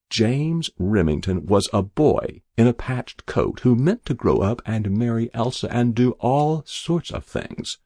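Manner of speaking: ordinary delivery, nothing marked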